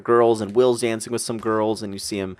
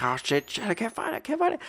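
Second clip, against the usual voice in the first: much higher, softer frantic muttering; panicked fumbling; 12 semitones higher, 5 dB quieter than usual. Retold or imitated. Hunting for contacts on her phone, high soft panic. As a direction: fret under your breath as you fumble the phone. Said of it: "I'm like".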